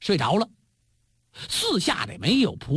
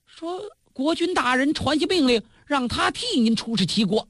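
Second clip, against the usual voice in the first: plain reading voice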